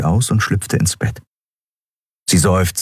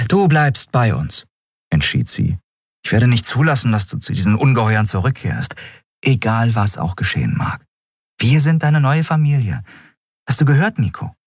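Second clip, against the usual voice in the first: a higher, resolute captain's voice; captain Andriel, firm; 6 semitones higher than usual. Acted. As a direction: lay down the law, protective captain. Captain Andriel speaks firmly.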